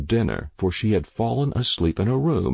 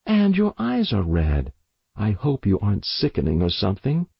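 fussy mother. Acted. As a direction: fuss over her like a worried mother. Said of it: "Mother remarked".

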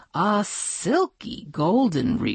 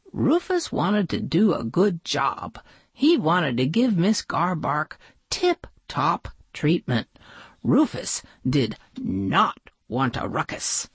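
ordinary delivery

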